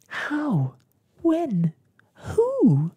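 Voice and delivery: high, loud one-word questions; excited high-pitched outbursts. A narrator's reading. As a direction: fire each question high, falling sharply. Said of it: "very excitedly".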